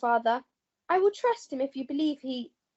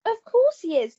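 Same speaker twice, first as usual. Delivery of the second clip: sour, sneering "of course" pitched up and louder; bitter sarcasm; 5 semitones higher, 5 dB louder than usual. Acted. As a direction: spit it out with resentment. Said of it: "don't get bitter".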